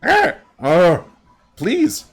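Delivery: high, urgent broken-speech bird voice; high, panicky creature voice in short bursts. Acted. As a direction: squawk it out like a frantic bird-man. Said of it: "and he's like".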